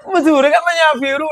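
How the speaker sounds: put-on high Madurese accent, indignant; old Madurese man. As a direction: protest in high village Madurese. Old man argues blue. (logat Madura tinggi, protes)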